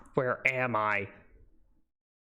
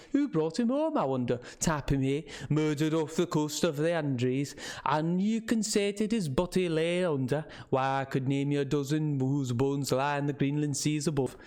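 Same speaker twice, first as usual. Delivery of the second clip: put-on old local man's northern English with Scots touches; rustic northern English, slipping toward Scottish; northern English dialect. Old man scoffing to visitors about warnings, northern English dialect. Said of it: "did not quite understand his dialect".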